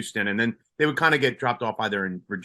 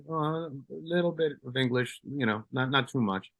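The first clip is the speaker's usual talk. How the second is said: soft, hesitant broken English, playing the newcomer; a lightly voiced shy foreigner with little English; playing dumb immigrant; 7 dB quieter than usual. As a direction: mumble it like you barely speak English. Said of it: "And I'm like".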